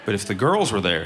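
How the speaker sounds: ordinary delivery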